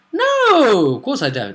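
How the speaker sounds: high, loud, drawn-out denial; mock callous outburst. Game master denies caring about players, loud and theatrical. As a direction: burst out high with exaggerated scorn.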